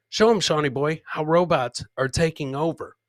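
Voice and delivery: usual speaking voice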